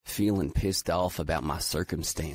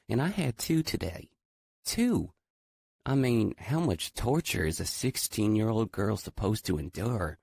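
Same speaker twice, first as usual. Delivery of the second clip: put-on higher, giggly teen girl; bright young Southern girl teasing; lightly voiced girl; 4 semitones higher than usual. A narrator's reading. To Adam about her dog, a higher playful girl voice.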